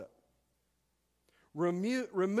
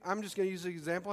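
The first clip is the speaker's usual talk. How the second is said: normal talking voice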